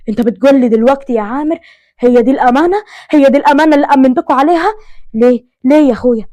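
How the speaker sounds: loud, furious reproach of his brother; angry outburst. Acted. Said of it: "محمود قام وقف بغضب". (أب غاضب يصرخ في أخوه بسبب الأمانة)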